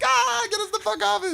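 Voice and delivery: very high squealing mock panic; high comic terror; mock panicked plea. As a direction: squeal it like a frightened extra. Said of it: "They're like".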